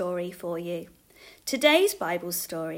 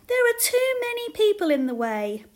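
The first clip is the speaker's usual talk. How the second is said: put-on high, loud child's complaint; little girl; 12 semitones higher, 7 dB louder than usual. A narrator's reading. Little girl stuck behind the crowd, childish complaint. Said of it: "said a little girl".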